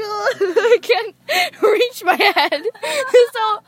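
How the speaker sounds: words break up with laughter; laughing. Real, not acted. Girl laughing at her own clumsy hair routine. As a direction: laugh through the struggle. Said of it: "my right hand is just, like, crippled".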